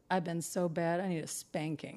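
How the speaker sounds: low, soft imitation of a man; mock naughty pleading. Drunk boyfriend on all fours asking her, low soft confession. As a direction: drop deep and hushed. Boyfriend's kinky request retold.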